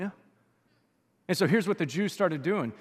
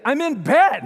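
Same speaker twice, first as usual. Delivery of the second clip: higher, louder wife calling back from bed; wife answering from bed, raised and higher; 7 semitones higher, 11 dB louder, slower than usual. Retold or imitated. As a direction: call back annoyed from bed. Wife's reply voiced.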